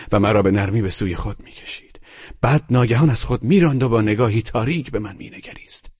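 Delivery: plain reading voice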